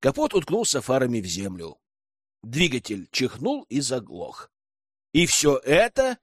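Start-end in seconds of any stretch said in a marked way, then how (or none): none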